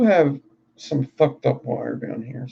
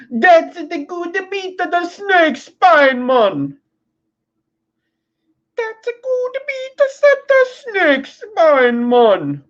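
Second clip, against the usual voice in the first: much higher, slow mock accent sliding Italian to Jamaican; Italian-Jamaican mix accent; 14 semitones higher, 4 dB louder, slower than usual. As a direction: ham up an Italian turned Jamaican. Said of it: "I was Italian and I moved to Jamaica".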